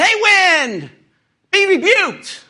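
loud high command at the wind, hammed-up; mock rebuke. Young tennis player rebuking the wind, loud high hammed-up command. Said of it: "I look and say".

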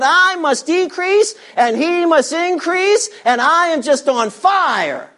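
high, slow, impassioned declaration; fervent devotion. Acted. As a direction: proclaim it with burning zeal, drawing out the key words. Preacher voices John's burning devotion.

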